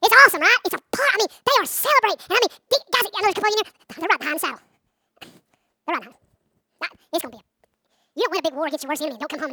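ordinary talk

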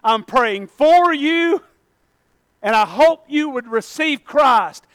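slow, tender, earnest assurance; gentle encouragement. Retold or imitated. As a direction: lean in and say it kindly. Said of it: "come to him all the time and say".

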